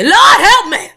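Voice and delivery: loud, high cry for help; pleading outburst. Acted. Reader breaking out in a plea to the Lord, loud pleading. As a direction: burst out, begging the Lord for help.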